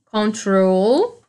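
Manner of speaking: "control" very slow, with TROL drawn out; stress on second syllable. Shown as a demonstration. Teacher showing the strong syllable, slow stressed TROL in "control". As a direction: lean long on the last syllable of "control". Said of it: "troll é minha sílaba mais forte".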